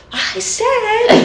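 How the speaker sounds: high, exaggerated exasperated repeat; mock losing patience with someone who can't hear; hammed-up fed-up outburst; acted aggravation. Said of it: "it just gets aggravating".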